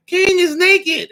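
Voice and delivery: put-on very high, slow child's announcement; little kid. A little kid in the crowd calling out, very high and slow. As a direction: say it plainly like a small child.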